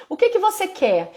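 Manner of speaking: put-on high, bright question to a small child; mother prompting her child for a word; parent asking child. Shown as a demonstration. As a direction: ask it like coaxing a toddler. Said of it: "aí você pergunta".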